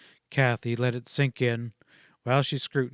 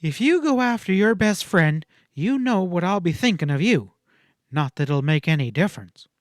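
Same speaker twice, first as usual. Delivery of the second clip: bitter warning; 5 semitones higher, 5 dB louder than usual. A narrator's reading. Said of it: "Kathy refuses to help".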